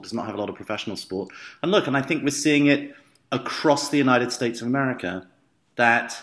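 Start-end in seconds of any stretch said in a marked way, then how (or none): 0.0-1.3 quicker, softer repeat with stress on "not"; fast quiet aside. Real, not acted. Repeating the point about Louisville's pro sport as a fast, softer aside.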